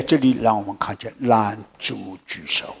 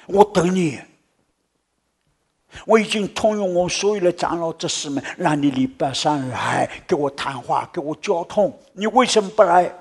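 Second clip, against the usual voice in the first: eager reproach; 7 semitones higher, 4 dB louder than usual. Retold or imitated. Pastor calls out reproachfully.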